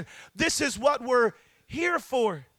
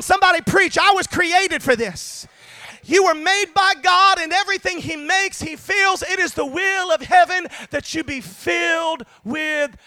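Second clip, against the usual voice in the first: loud, raised-pitch revival preaching; full-force preaching; 4 semitones higher, 7 dB louder than usual. Real, not acted. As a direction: rouse the congregation with a loud exhortation.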